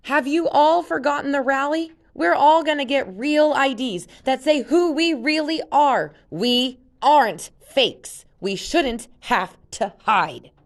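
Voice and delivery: high, loud rallying cry, slowing to a halt; impassioned shout. Acted. Jen rallies the other kids, loud and fierce.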